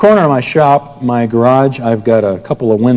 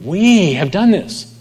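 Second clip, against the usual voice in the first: "We" slow and lifted high, "have done this" following; stress on we; 7 semitones higher, slower than usual. Shown as a demonstration. Preacher showing how Peter stressed "we", slow. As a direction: lean hard on "We" and draw it out. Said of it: "Peter emphasizes the word "we".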